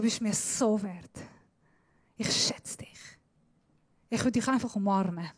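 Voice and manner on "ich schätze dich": breathy whispered affection; whispered words of love to a child. Shown as a demonstration. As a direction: whisper it tenderly. God's loving words acted out.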